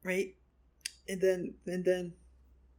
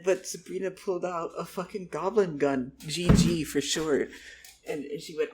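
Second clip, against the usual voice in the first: ordinary talk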